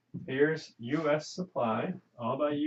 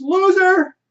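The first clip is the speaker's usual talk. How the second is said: full-throated scream, much higher and louder; furious yell; 16 dB louder than usual. Retold or imitated. Woman screaming at the other driver, full-voice furious yell. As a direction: she yells at the top of her voice. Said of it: "at the top of her voice, she yells".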